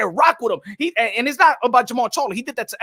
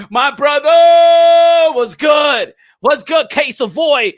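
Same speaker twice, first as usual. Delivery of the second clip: stretched, much louder hype shout; louder, higher excited welcome; excited greeting; 3 semitones higher, 7 dB louder, much slower than usual. Real, not acted. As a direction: holler a big welcome to a friend.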